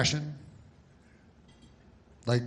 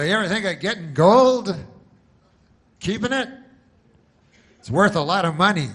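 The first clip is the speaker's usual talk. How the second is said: very loud, high-pitched mock sales pitch; commercial pitchman; 8 semitones higher, 9 dB louder than usual. Guy in a commercial selling viewers on gold, loud mock pitch. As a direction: blast the hard sell at the viewer. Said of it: "have a guy in the commercial".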